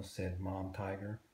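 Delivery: ordinary delivery, nothing marked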